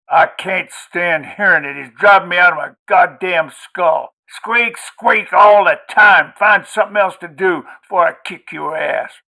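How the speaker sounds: put-on enraged drunk shouting, high and loud; angry yelling. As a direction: rant at him furiously. Drunk husband rages at boy.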